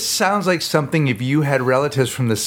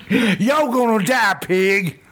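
mock yell; 7 semitones higher, much slower than usual. Repeats yell at pig.

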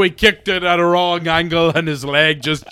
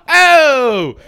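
mock shout; 8 semitones higher, 7 dB louder than usual.

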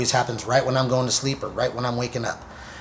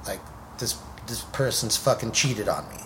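ordinary delivery

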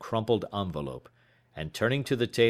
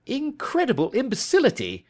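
high, loud exclamation of disbelief; detective fuming at the police, crying out; outraged cry; 6 dB louder than usual. A narrator's reading. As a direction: explode at the stupidity, pitch way up. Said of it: "he cried".

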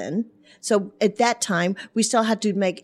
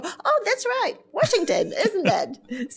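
much higher, sing-song, feigning innocence; innocent correction; 12 semitones higher than usual. Retold or imitated. At her citizenship test, caught out, a high innocent "oh, that's right". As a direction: pretend you knew it all along, voice up high.

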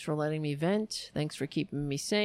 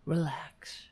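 whisper; 4 semitones lower, 4 dB quieter than usual. Real, not acted. Whispering to himself after sigh.

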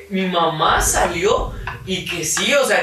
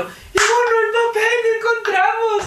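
janitor shouting. Retold or imitated. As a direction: shout it across the schoolyard. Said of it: "le gritó de la malla".

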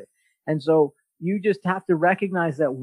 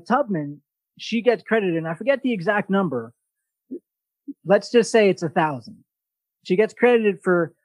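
ordinary talk, nothing marked

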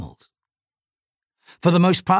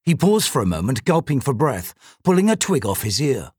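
usual speaking voice

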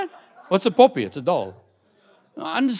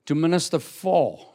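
"for" leaned on, the key word of "To minister for."; stress on for. Shown as a demonstration. Preacher correcting the church's reading, hitting "for". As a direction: land the weight on "for" with a falling close. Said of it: "Underline the word for".

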